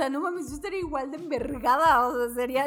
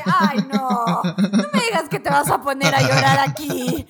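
mocking scorn; 8 dB louder than usual. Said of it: "El güey está llorando y dice".

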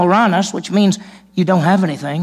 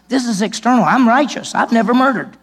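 higher, louder smug religious leader; self-righteous boast; 6 semitones higher, 4 dB louder than usual. Retold or imitated. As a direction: brag about your own virtue. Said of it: "the religious leaders would say something like this".

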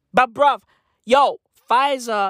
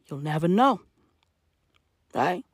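ordinary talk, nothing marked

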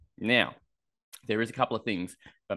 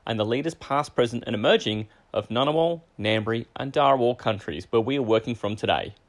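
formal acknowledgement; 4 semitones higher, 6 dB louder than usual. Acted.

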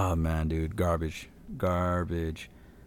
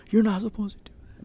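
put-on pitched-up scolding girl voice; high angry girl; 13 semitones higher than usual. Scare actor scolding a rude guest in a pitched-up voice. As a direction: squeak it like an offended scare actor. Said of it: "all I heard was like".

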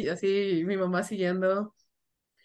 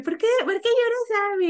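put-on high, teasing little-kid question; mock little sister; 12 semitones higher than usual. Little sister teasing her crying big sister, high and innocent. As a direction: pitch way up like a small child asking. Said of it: "y yo".

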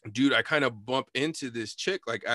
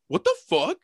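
high, loud mock outrage; outraged retort; 12 semitones higher, 5 dB louder than usual. Bumped woman confronts the teller, outraged. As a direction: snap back, pitched way up.

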